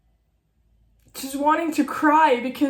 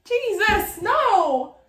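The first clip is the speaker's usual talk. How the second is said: high, tearful outburst at oneself; upset, near tears; 8 semitones higher, 5 dB louder, much slower than usual. Real, not acted. Writer embarrassed at crying on camera, tearful protest. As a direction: cry it out in protest, voice jumping up. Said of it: "embarrassing to cry on camera".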